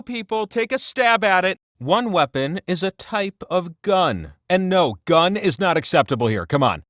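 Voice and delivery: ordinary talk, nothing marked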